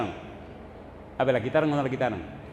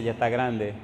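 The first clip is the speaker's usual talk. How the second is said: normal talking voice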